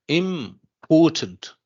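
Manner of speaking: "important" stretched slowly, im, pause, PORtant; stress on PORT. Shown as a demonstration. Teacher asking students "what about", slow "important" split to show PORT. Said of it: "Port here is the stressed syllable".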